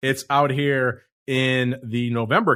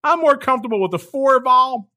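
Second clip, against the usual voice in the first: high, lightly voiced imagined listener being smug; mock listener voice; 12 semitones higher, 5 dB louder than usual. Host voicing a hypothetical listener, lightly mocking and high. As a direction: pitch it way up like a listener speaking. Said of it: "you said".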